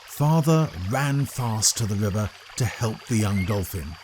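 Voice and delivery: plain reading voice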